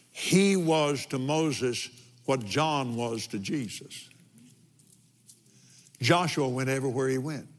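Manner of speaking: usual speaking voice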